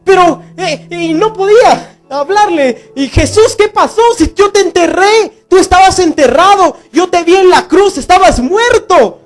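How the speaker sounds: overjoyed disbelief. Acted. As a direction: burst out in stunned delight. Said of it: "no cabía en su felicidad ni podía hablar".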